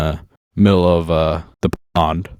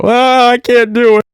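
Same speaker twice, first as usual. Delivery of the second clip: put-on high panicky wail of a frightened child; scared kid; 6 dB louder than usual. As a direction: cry out like a kid too scared of the escalator. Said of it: "I rip my hand and I go".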